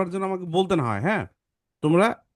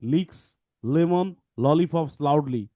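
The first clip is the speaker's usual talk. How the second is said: slow, deliberate, every L sounded; slow tongue twister; much slower than usual. Shown as a demonstration. Teacher modelling the tongue twister for students, slowly. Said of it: "আমরা একটু দেখি".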